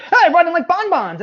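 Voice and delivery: high, loud sugary announcement; mock chipper coworker. Mocking a chipper coworker who brought treats, high loud sugary voice. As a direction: sing it out like the office sweetheart. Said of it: "the super chipper".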